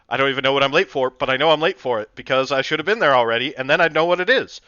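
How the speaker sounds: loud, flustered rush; talking fast. Shown as a demonstration. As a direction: gabble on in a rising panic about being late. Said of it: "kinda talking fast".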